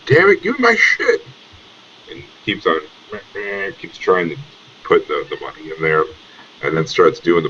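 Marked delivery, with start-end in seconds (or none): 0.1-1.2 angry yell. Acted. Frustrated NPC yelling at machine.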